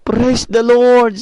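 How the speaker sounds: high, loud, slow praise the Lord; drawn-out grateful cry; joyful praise. Acted. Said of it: "sabi niya".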